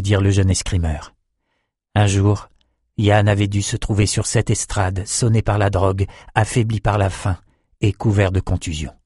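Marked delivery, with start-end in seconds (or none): none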